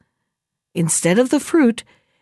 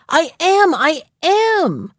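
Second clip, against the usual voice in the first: put-on higher-pitched stubborn child; insistent protest; 7 semitones higher, much slower than usual. A narrator's reading. Boy defiantly insisting to mother.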